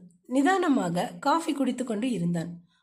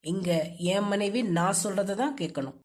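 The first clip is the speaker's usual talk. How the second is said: low, controlling husband's voice; low-pitched, domineering man; stern husband; 6 semitones lower than usual. Acted. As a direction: lay down the rule to your wife, low.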